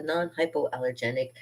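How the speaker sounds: ordinary talk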